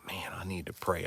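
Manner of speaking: a hushed, desperate aside to himself; whispered plea. Retold or imitated. Retelling how he'd whisper when backed into a corner, barely audible. Recalling desperate moment, praying.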